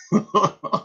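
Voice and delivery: real burst of laughing; laughter. Real, not acted. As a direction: break off and laugh. Laughs at people's blind belief.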